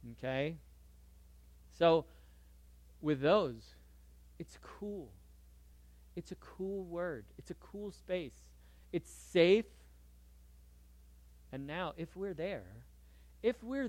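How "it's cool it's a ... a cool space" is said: whisper. Real, not acted. Sudden hushed aside.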